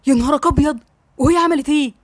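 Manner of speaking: mildly alarmed exclamation; a touch of stunned dismay; shocked. A narrator's reading. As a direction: react with understated shock to bad news. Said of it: "سليم بصدمة".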